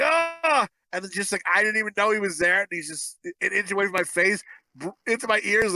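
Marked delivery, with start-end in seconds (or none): 0.0-0.7 sudden high yelp; startled yelp. Retold or imitated. Caught by stepfather, startled mock yelp. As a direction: jump at the surprise. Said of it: "I'm like".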